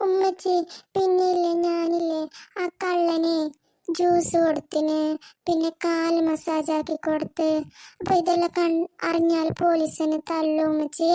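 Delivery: slow, hesitant worried confession; scared child. Acted. Child confessing to mother about helping the thief, timid and slow. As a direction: drag out each phrase, timidly. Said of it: "നമുക്ക് പേടിയാണ് ഉമ്മച്ചി".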